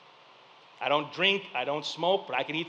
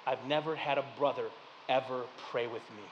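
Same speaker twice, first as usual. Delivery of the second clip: soft, quiet; 8 semitones lower, 11 dB quieter than usual. Retold or imitated. Grieving friend quoted softly.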